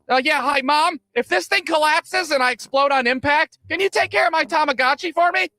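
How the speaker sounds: high-pitched mock plea to mom; mock worried kid. Kid calling mom before the coaster drops, mock worried.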